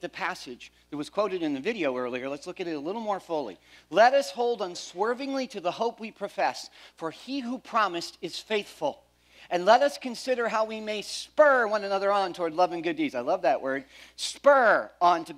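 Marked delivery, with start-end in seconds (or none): none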